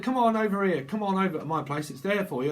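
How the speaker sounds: loud, high game show host hype; hammed-up quiz host luring a losing contestant; Bob Holness host voice. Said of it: "Bob Holness".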